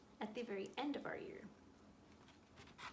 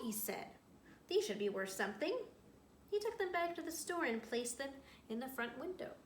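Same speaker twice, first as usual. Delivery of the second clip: reading a story aloud to a class; storyteller voice for young listeners; picture book read-aloud; 5 dB louder, slower than usual. A narrator's reading. Teacher reads picture book aloud.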